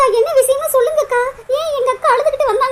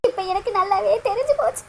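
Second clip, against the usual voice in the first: quiet sob; 5 semitones lower, 5 dB quieter than usual. Acted. Crying, then repeats it louder.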